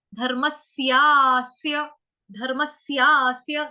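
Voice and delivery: slow धर्मस्यास्य, s joined into a long syaa; deliberate chant of धर्मस्यास्य with both half s sounds. Shown as a demonstration. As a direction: stretch धर्मस्यास्य on the aa. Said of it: "आधा स जोड़ना है".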